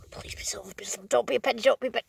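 whispered, hopeful pleading to the coin. Real, not acted. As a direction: breathe it out as a wish under your breath. Pleading over a find.

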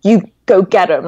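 imitated proud encouragement; proud cheering-on. Man at Walgreens urging her on, imitated pride. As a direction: retell a stranger cheering you on with pride. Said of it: "saw it as a point of pride".

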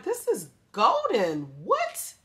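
high-pitched, drawn-out disbelief; re-enacted squeaky "what?" of amazement; amazed reaction. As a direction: shoot the pitch way up in astonishment. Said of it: "I just was like".

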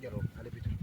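whispered coaxing. Real, not acted. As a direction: breathe the words to calm the dog. Hayvana fısıldıyor. (fısıldayarak)

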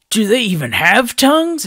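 high, loud disbelief; appalled at the sight; incredulous. A narrator's reading. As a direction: Oniwa gaping at the spiders. Oniwa appalled.

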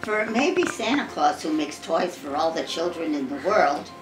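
usual speaking voice

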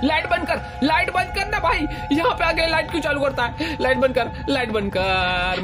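high, urgent begging to turn the light off; frantic high plea that slows down at the end; panicked pleading. Real, not acted. Begging someone to switch off light.

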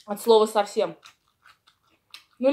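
normal talking voice

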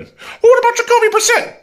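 high, squeaky voice of an imagined doubter; mock objector voice. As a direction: pipe up as a skeptical fan butting in.

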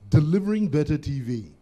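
loud, booming station ident voiceover. Shown as a demonstration. Guest doing a requested snippet of his TV voiceover, announcer voice. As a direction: announce the channel slogan loudly, like a TV promo. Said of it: "the voiceover you did for".

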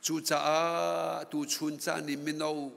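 usual speaking voice

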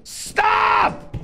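high, loud frantic yell; real shout. Real, not acted. Gamer yelling at the game, frantic shout. Items keep dropping.

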